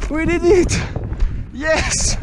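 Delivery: excited. Real, not acted. Reaching the summit, celebrating.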